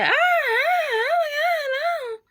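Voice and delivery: very high, slow, crying wail; imitating a crying girlfriend's slow high wail; panicked wailing. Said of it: "she just over there like".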